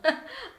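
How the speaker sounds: high, forced little laugh. A narrator's reading. Genesis laughs along with the mocking girls, forced high laugh. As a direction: fake a laugh to fit in. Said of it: "I force myself to laugh right along with them".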